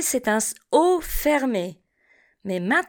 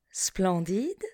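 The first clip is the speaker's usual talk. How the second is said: Splendide repeated much softer; 3 semitones lower, 8 dB quieter than usual. Shown as a demonstration. Teacher echoes Splendide quietly for the class. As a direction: softly echo Splendide. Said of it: "Répétez après moi".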